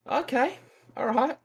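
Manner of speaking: high, slow, nervous okay; a timid kid agreeing despite himself; mock scared squeak. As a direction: play it small and scared. Scared reply to older bully.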